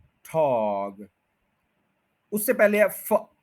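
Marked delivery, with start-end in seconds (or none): 0.0-1.1 थॉग drawn out low and slow; with full style. Shown as a demonstration. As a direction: linger on थॉग with full energy. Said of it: "पूरा style के साथ बोलें".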